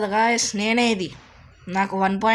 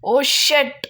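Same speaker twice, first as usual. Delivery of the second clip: loud, high cry of dismay; dismayed outburst; 6 semitones higher, 7 dB louder, much slower than usual. Real, not acted. Player just got killed in the match, dismayed outburst. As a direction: burst out in real frustration.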